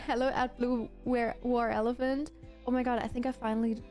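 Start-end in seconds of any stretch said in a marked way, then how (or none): none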